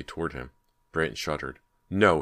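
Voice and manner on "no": loud frightened no; crying out. A narrator's reading. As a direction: cry out in fear. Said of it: "he cried".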